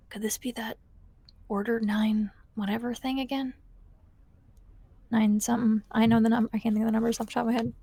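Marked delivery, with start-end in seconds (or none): 0.1-3.5 hushed, slightly higher in-character aside; under her breath. Acted. Tig wondering about the order as the team goes up, under her breath. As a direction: murmur it to yourself, barely voiced. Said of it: "kinda under her breath be like".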